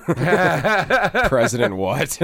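slow, high, stretched and laughing; laughing disbelief. Retold or imitated. Waking to hear a president named, laughing in disbelief. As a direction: drag out the question through laughter. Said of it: "You're like".

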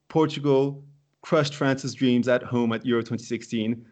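usual speaking voice

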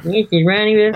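whiny nasal mimicry of a friend; nasally voice. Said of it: "quoted it, like, in a, like, a nasally voice".

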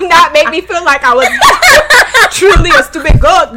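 high, loud laughter through words; can barely speak for laughing; laughing hard. Real, not acted. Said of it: "I am weak and y'all childish".

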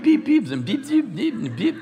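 high-pitched imitated censor beeps; string of squeaky mock beeps; bleeped-out swearing. Pastor imitating a person's censored swearing, high beeps. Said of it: "this person's swearing".

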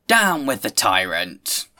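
loud, slow mock-rally cry; theatrical, drawn-out call of revolt; rebellious cry. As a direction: call it out like a protester, drawing out each word. Rebellious group heckles the speaker.

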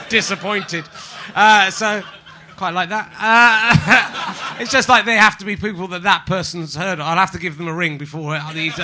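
ordinary delivery

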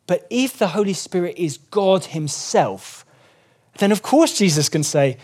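ordinary talk, nothing marked

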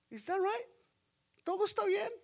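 can't believe what he just heard; incredulous echo. Acted. Pastor to congregation, mocking disbelief at "all is fine".

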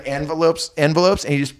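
ordinary talk

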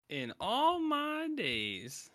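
very high, drawn-out mock shock; hammed-up high-pitched indignation; scandalized lady. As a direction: stretch it out like an affronted lady. Co-host continues offended lady impression.

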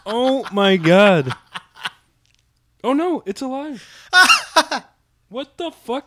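high squealing laugh of disgust; shocked laughter. Real, not acted. Live bug on shoulder.

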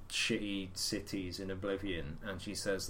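usual speaking voice

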